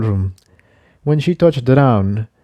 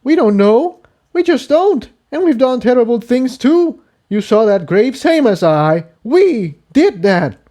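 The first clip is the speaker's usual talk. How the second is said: much higher, heavy guilt; higher colt voice, grim; Jigsaw, accusing; 13 semitones higher than usual. Acted. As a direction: make her face it.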